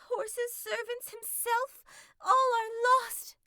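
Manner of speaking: high, loud, breathless fox; gasping out bad news to the king; frantic, slowing to a wail at the end; panting. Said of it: "panted the fox".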